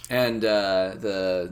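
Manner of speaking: usual speaking voice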